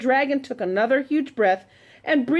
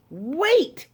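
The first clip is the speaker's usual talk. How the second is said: higher, urgent call of "Wait!"; shouted; 7 semitones higher than usual. Acted. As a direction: stop the dragon before the door shuts. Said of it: "shouted Elizabeth".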